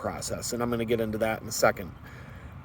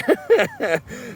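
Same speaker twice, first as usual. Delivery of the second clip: high laugh. Real, not acted. Laugh after the squeal.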